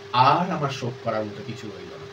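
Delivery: low and faint, grief-worn; weak voice. Acted. Mother giving up on her mourning, in a weak voice. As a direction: speak softly, with no strength left. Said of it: "একটা দুর্বল কণ্ঠস্বর".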